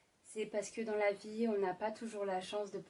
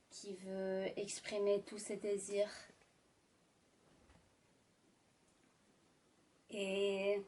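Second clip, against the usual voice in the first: sultry narrator. Acted. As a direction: draw them into a romantic scene. Sultry ASMR romantic narration.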